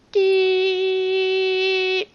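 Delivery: high sustained electronic tone; high beep. Pretend. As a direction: hold a piercing test tone.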